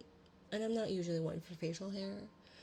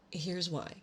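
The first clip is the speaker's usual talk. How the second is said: plain reading voice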